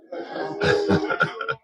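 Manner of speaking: a quick breathy chuckle at his own question; short real laugh. Real, not acted. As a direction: laugh briefly, amused by the crowd. Laughs after asking are you happy.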